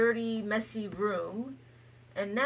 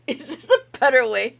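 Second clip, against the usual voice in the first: laughing; 13 dB louder than usual. Real, not acted. Laughing as lesson resumes.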